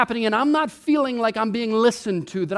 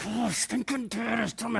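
much softer sulky muttering; grumbling kid; 2 semitones lower, 10 dB quieter than usual. Retold or imitated. Kid sulking about dad, quietly grumbling. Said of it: "they're over there like".

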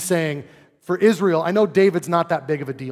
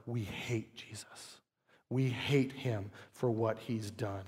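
softer, slow, sneering mock Pharisees; mock Pharisee hatred; 6 semitones lower, 13 dB quieter, slower than usual. Preacher mocking the Pharisees' hatred of Jesus, soft and slow. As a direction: growl it low like a bitter Pharisee.

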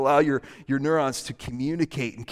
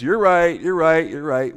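loud, hurried brush-off agreement; dismissive agreement; 6 dB louder than usual. Acted. Someone ending an argument by conceding, loud and dismissive. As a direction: agree just to shut them up. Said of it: "which is basically, Stop talking to me".

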